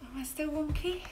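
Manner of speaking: ordinary delivery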